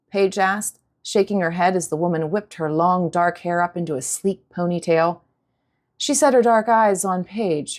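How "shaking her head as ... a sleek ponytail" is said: usual speaking voice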